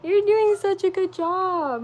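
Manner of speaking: high, slow, tender praise on the edge of tears; tender teary praise. Real, not acted. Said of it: "Am I really gonna cry".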